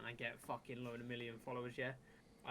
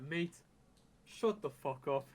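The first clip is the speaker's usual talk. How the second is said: a louder, slowed-down put-down; loud retort; 3 semitones higher, 9 dB louder, slower than usual. Retold or imitated. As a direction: hammer each word of the put-down. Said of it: "And he's like".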